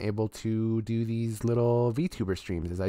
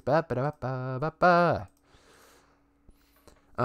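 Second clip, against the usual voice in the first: alien babble; 3 semitones higher than usual. Retold or imitated. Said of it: "he talks like this".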